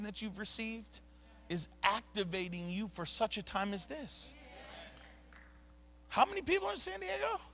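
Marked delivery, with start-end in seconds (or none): 6.1-7.4 fast and much higher, firing the question at them; rally the crowd with a sudden question; excited challenge. Real, not acted. Pastor firing up the church about San Diego, excited and fast.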